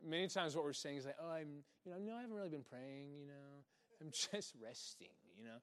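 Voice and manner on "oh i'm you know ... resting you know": mock sheepish excuse.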